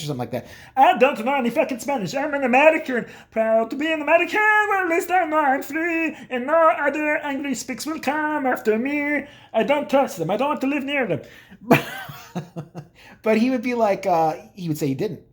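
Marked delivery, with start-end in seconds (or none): 0.8-11.2 imitated dad. Dad refusing to teach his child Spanish, mock indignant. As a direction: put on a proud, grumbling American dad. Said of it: "I'd be like, Hey, speak, teach me some Spanish".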